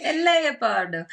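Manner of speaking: joking Italian word for leopard; mock Italian. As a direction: stretch "El leopardo" in a fake Italian accent. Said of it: "So good at accents".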